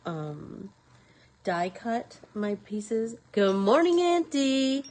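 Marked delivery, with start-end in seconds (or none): 3.3-4.8 higher, louder hello across the room; calling out a greeting. Real, not acted. Crafter calls a greeting to her aunt, loud and high. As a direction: call out good morning to auntie. Greeting someone who walks in.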